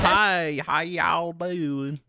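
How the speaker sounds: high, slow hammed-up country greeting; poking fun at a fake country accent, slow and high; mock country accent. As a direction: stretch out y'all like a cornball country boy. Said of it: "like, country guy accent".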